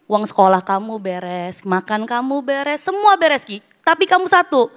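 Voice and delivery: mother retelling how she scolded her son; retold angry scolding. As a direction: scold the child in exasperation. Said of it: "Aku marah banget".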